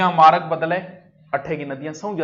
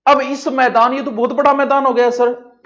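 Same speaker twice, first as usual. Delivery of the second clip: higher, louder voice of an amazed pupil; student's voice; 8 semitones higher, 8 dB louder than usual. Pretend. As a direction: play the awed student. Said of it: "हाँ मुन्ना".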